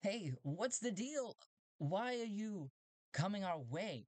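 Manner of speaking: high robot voice; strange robot. Acted. Robots challenging the approaching ship, high robot voice. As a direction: voice the curious robots high and slow. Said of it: "The robots are like".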